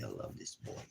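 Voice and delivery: soft whisper, "love" gently leaned on; tender whisper. Real, not acted. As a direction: whisper it lovingly to the puppy. Tender words to his puppy.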